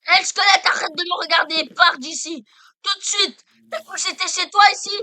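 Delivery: angry yelling. Real, not acted. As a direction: shout him off, loud and high. Yelling at skeleton in game.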